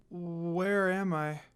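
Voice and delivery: slow, dazed waking moan; groggy moan. A narrator's reading. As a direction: drag the words out like just waking in pain. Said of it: "Kagome moaned".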